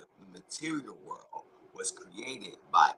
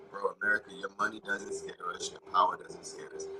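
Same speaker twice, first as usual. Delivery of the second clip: ordinary talk, nothing marked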